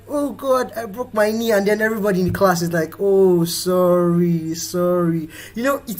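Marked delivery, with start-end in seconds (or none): 0.1-1.5 higher-pitched wail, lightly acted; mock pained complaint. Schoolchild who fell while running, mock pained complaint. Acts child who broke knee. 3.0-5.3 long drawn-out commiseration; mock sympathy. Class consoling the child who fell, mock sympathy.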